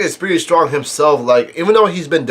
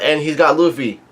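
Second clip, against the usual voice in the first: normal talking voice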